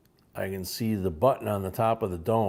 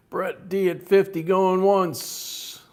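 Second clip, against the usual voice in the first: loud, slow, high bid call; auctioneer call; 9 semitones higher, 6 dB louder, slower than usual. Acted.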